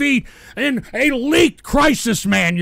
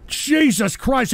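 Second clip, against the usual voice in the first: usual speaking voice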